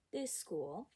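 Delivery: ordinary delivery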